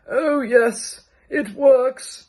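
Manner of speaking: put-on high, firm old man's voice; an old man insisting, high-pitched. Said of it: "said one of the old men".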